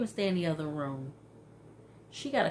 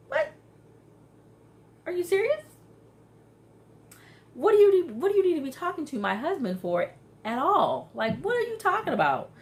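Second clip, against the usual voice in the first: much higher and louder, indignant; outraged disbelief; 12 semitones higher, 6 dB louder than usual. Real, not acted. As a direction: call out the friend in outrage. Outraged reaction to friend.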